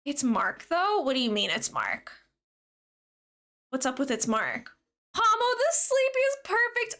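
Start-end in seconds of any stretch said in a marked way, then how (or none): 5.1-7.0 delighted squeal. Real, not acted. Delighted reading of viewer's Pokemon.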